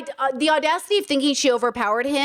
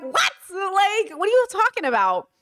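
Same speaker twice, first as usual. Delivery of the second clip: higher-pitched, baffled retort; mock disbelief; 7 semitones higher than usual. Commentator reacts to the truth-teller praise, mock disbelief. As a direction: act incredulous, pitch jumping up.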